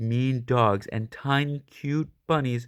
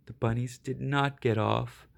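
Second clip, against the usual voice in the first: ordinary delivery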